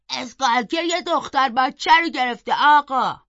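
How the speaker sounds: loud reply across a distance; calling back. A narrator's reading. Servant answers Linton about the caught girl, calling loudly. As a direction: raise the voice to report to the master.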